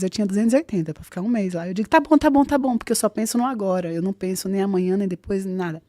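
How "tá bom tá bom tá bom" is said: hurried agreement. Retold or imitated. Accepting the taxi fare in a hurry.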